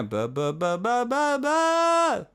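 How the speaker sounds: sung bu climbing into a loud push; pushed yelling bu. Shown as a demonstration. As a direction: yell the top note out. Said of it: "it's a yell, it's a push".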